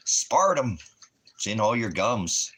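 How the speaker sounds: loud, high rant. Real, not acted. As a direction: blurt it out loudly. Ranting about aspartame.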